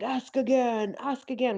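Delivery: a hint of a stern, insistent man. Fan imitating Shiloh's stern voice with the women, lightly. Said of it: "does that stern voice".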